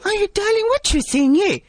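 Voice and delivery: man playing a doting Durban auntie; sweet-talking aunty character voice; Auntie Sheila voice. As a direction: male comic in a female auntie voice. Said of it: "you change into a female voice".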